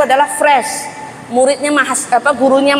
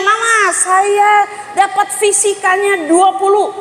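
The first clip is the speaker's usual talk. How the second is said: put-on child reporting grade; 7 semitones higher, slower than usual. Son reporting his physics score.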